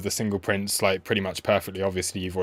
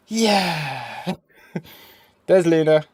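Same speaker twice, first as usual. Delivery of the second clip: pet talk; 6 semitones higher, 5 dB louder, much slower than usual. Real, not acted. Showing dog, laughing.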